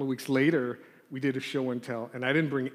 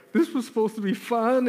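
put-on higher, mildly tearful kid's protest; mock tearful; 6 semitones higher than usual. Kids crying after being scolded, lightly mock-tearful complaint. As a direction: imitate a child complaining through tears. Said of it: "crying and tears are coming down".

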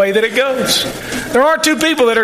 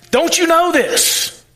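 slow, much higher, incredulous jab; sarcastic retort; 8 semitones higher, slower than usual. Retold or imitated. Preacher voicing Jesus rebuking the Pharisees, sarcastic. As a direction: let them feel how obvious it is. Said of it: "he's sarcastic".

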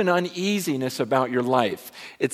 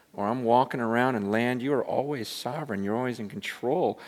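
an intimate whispered-down prayer, much softer; hushed prayer; 3 semitones lower, 8 dB quieter than usual. Retold or imitated. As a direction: pray quietly as if deep underwater. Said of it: "I was praying".

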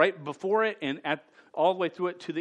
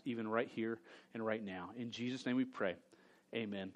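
soft, low prayer voice, fading; 6 semitones lower, 8 dB quieter than usual. Acted. Pastor leads the church in a soft, low prayer. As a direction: pray humbly for the congregation.

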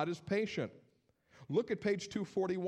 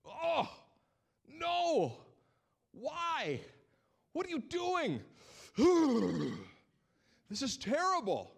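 much higher, dismayed cry of disbelief; appalled; 12 semitones higher, 4 dB louder, slower than usual. Pretend. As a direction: react like someone watching a disaster. People should look at this and be appalled.

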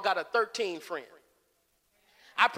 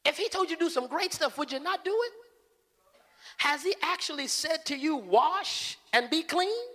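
polite servant; 4 semitones higher than usual. Acted. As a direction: coax him kindly, humbly. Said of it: "Real polite".

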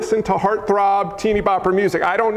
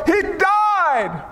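high, drawn-out cry; acted crowd alarm; 9 semitones higher than usual. Crowd seeing the boy look dead, high alarmed cry. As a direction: cry "died" high like a shocked onlooker.

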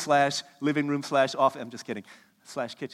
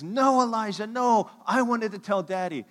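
higher, louder imitation of an upset kid; protesting child; 7 semitones higher, 6 dB louder than usual. Daughter objects that brother told Daddy first, mock protest. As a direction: drag out a child's indignant protest.

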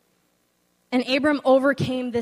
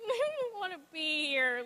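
soft, higher crying voice; retold sobbing; 5 semitones higher, 9 dB quieter than usual. Speaker telling her friend she is unhappy, soft tearful crying. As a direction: let the words break into a sob. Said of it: "I start sobbing".

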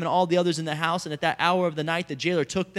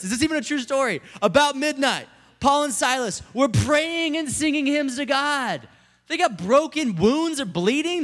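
high, raised voice of amazement; full-force preaching; 8 semitones higher, 6 dB louder than usual. Real, not acted. Preacher marvels at prisoners singing to God, impassioned preaching. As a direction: preach it incredulously, pitched up.

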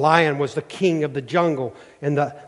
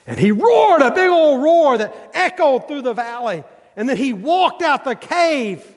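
loud telling of the roar; 9 semitones higher, 4 dB louder than usual. Acted. Lion's roar told loudly.

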